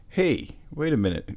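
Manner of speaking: ordinary delivery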